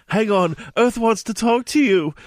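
mild mock scream as Hermione; a hint of a shriek. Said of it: "Hermione screamed".

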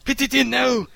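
high, urgent gasping plea; gasped cry. A narrator's reading. Old woman gasping at Skinner, a high urgent cry. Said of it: "she gasped".

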